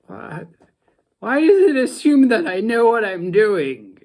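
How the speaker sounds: high, loud baffled complaint; exasperated. Real, not acted. Novice tea maker complaining about the instructions, exasperated. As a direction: protest loudly at being expected to know.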